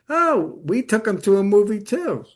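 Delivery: mock-surprised sister, pitched well up; high "oh", then the discovery; acted surprise. Sister to siblings about Dad's movie, surprised.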